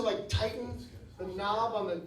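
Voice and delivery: ordinary delivery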